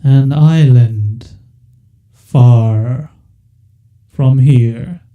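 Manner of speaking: very slow, loud whale voice; giant whale voice. Acted. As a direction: drag each word out like a giant beast. Whale answers the party.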